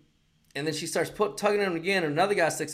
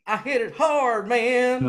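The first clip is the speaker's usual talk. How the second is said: mock golfer boast; 9 semitones higher, 6 dB louder, much slower than usual. Mocking a golfer.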